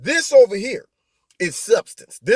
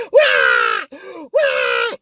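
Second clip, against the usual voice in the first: mock baby wail; 7 semitones higher than usual. Host imitating a kid throwing a fit, mock crying at full lung. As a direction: wail "wah" like a bawling kid. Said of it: "starts crying at the top of their lungs".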